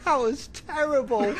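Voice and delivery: high, slow words broken by real laughter; laughing. Real, not acted. Comedian mocks his own impression, laughing. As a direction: crack up while admitting it.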